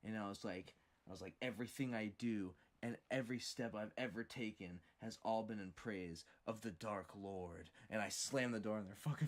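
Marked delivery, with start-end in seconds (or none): 1.4-7.7 turning serious to scare off the visitors; dead serious. Retold or imitated. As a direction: drop the smile and go grave. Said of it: "to looking completely serious".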